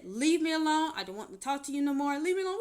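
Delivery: loud, high yelling; hollering. Retold or imitated. Yelling at her ex-husband on the street, hollering. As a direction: yell it at him to get away. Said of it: "I'm hollering at him".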